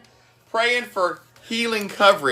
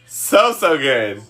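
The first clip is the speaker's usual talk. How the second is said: slowly drawn out, savoring; 3 semitones lower, much slower than usual. Real, not acted. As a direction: savor each word slowly. Raving about turkey burgers.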